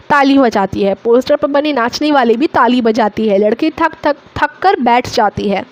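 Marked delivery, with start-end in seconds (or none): none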